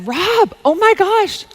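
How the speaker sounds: high, drawn-out "oh my gosh" excitement; excited retold exclamation. Retelling excited pitch to friend.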